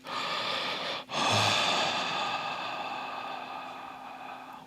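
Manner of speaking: one long, drawn-out breath sigh; deep breath. Shown as a demonstration. Meditation guide shows listeners a deep breath, long airy sigh. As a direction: breathe long and slow, letting it trail away. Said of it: "instead of taking deep breaths".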